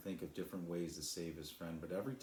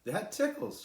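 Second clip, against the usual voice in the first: much higher, louder giggly line; laughing; 8 dB louder than usual. A narrator's reading. Cloud being tickled by the cloth, high and giggly. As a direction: squeal it like it tickles. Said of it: "she laughed".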